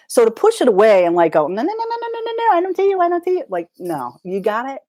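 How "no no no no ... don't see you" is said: high squealed no no no, hands over ears; mock panicked denial. Coaching a listener, acting out the panicked push-away. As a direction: squeal it away like a kid refusing to look.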